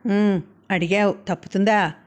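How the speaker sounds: usual speaking voice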